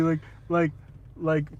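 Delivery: ordinary delivery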